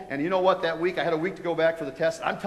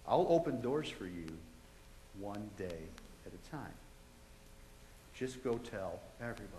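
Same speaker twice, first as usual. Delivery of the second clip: quiet voice of God; 6 semitones lower, 12 dB quieter than usual. Retold or imitated. God softly calling Jeff to come to him daily. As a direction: speak it gently like a voice from heaven.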